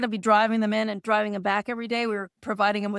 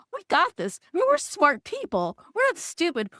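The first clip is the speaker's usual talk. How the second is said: higher-pitched retold rallying cry; self-assuring pep talk; 7 semitones higher than usual. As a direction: talk yourself up with conviction.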